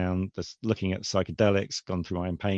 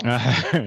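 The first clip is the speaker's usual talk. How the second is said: short laugh bursting out; amused chuckle before speaking; 4 semitones higher, 6 dB louder than usual. Real, not acted. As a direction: break into a brief, louder laugh. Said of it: "I'm laughing on the fact".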